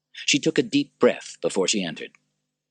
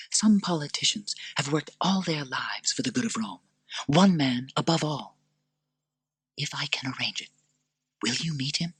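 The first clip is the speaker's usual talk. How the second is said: earnest Lucilla; 8 semitones higher than usual. Acted. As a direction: urge him gently.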